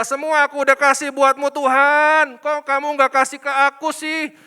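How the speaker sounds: loud, high nagging complaint to God; mock whining complaint. Believer nags God for unanswered blessings, loud and whiny. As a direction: grumble loudly like a spoiled believer. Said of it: "Kenapa ngomel-ngomel?".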